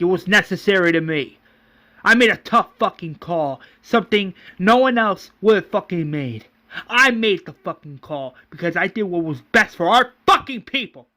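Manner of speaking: angry self-justification. Acted. Governor defends his tough call to Roland, angrily. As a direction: hammer the stressed words in angry defence.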